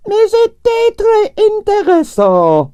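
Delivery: high, slow, imperious Russian lady; grande dame Russian ballerina. A narrator's reading. Narrator voicing Arienskaya, imperious and high. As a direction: sigh it out like a tragic prima donna.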